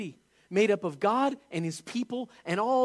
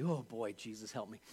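much lower and softer, under the breath; preacher mutters to himself while shifting, low and soft; muttered aside; 8 semitones lower, 11 dB quieter than usual. Real, not acted. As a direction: grumble a little prayer to yourself. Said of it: "muttered aside while moving".

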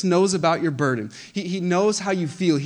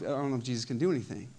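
much softer, quick, doubtful murmur; quiet doubt; 4 semitones lower, 8 dB quieter, faster than usual. Acted. A discouraged believer doubting Jesus, soft and quick. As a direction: let the doubt slip out under your breath.

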